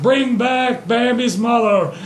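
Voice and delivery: high, slow, declaimed wish; Goku. Acted. Goku wishes to the dragon, high declaiming call. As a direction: call out a grand command, stretched out.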